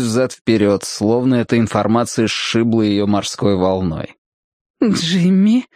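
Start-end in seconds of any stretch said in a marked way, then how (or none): 4.8-5.7 mock feminine whisper; whispering woman. A narrator's reading. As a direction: murmur his name up high, questioningly. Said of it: "прошептала женщина первому человеку".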